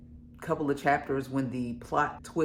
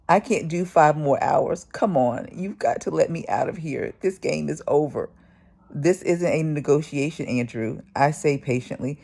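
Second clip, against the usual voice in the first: reading a novel aloud, louder and animated; story narration; 2 semitones higher, 6 dB louder than usual. A narrator's reading. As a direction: read the passage like an audiobook. Reads book aloud.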